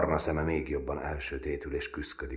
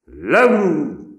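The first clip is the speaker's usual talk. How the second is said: high, loud snarled order; loud growling command; growled shout; 16 dB louder than usual. A narrator's reading. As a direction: snap it at the recruits. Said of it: "mordult rájuk".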